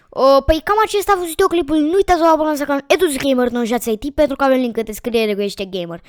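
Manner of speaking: high, fast, loud start that settles down; rushed outro. Real, not acted. Gamer wraps up the video for subscribers, rushed outro. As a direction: rattle off the sign-off in a hurry.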